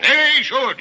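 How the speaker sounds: he should, shouted high and loud; furious shout. Acted. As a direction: bellow it in disgust. Scrooge insists on boiling idiots.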